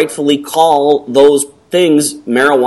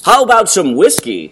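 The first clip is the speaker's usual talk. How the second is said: raised, put-on commercial pitch; radio ad man; 6 semitones higher than usual. Guy in radio commercial suggesting a drink, put-on salesman. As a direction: offer it like the man in an ad. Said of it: "And the guy goes".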